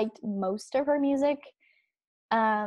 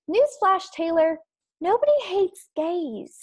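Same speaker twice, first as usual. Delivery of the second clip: emphatic retort; 8 semitones higher, 4 dB louder than usual. Real, not acted. Retort to [name].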